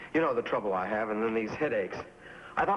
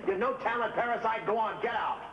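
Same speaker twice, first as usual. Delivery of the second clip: higher-pitched contempt; angry tirade; 6 semitones higher than usual. Acted. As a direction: sneer him toward the door.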